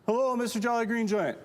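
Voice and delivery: high-pitched sustained hail to a giant; high, singsong holler; Trid calling up. Acted. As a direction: call upward in a little creature's high voice. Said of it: "go up and ask".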